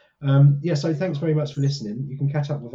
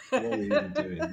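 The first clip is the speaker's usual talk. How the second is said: laughing disbelief; 11 semitones higher than usual. Real, not acted. Exasperated laughing self-mockery.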